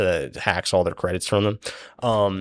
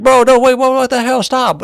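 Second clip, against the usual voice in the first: high, loud frantic protest; panicked yell; 10 dB louder than usual. Retold or imitated. Darrow tries stopping Goblin's beating, high panicked shouting.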